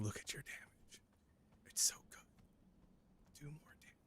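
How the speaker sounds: eerie tempting whisper, drawn out. Acted. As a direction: whisper it slowly into their ear, coaxing. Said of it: "whispering to my ear".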